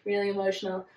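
mock choked up. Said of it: "You getting choked up about it?".

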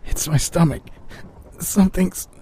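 thin, high and weak; weak, pained voice. A narrator's reading. Patient in agony answers partner, weak. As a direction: force it out weakly through pain. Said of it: "I left out a weak".

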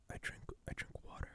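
hushed ASMR murmur; soft breathy ASMR whisper close to the mic. Shown as a demonstration. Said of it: "just A S M R".

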